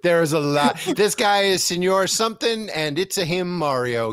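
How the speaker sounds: ordinary talk, nothing marked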